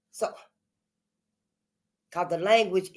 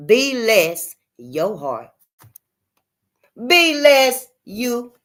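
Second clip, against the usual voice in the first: "Be less" drawn out slow and loud, pulled apart; split as be less; 3 semitones higher, 5 dB louder, slower than usual. Shown as a demonstration. Revealing the hidden meaning of bless, slow and loud. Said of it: "Might as well say it for what it is".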